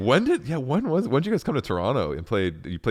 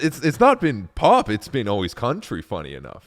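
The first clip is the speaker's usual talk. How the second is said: ordinary talk, nothing marked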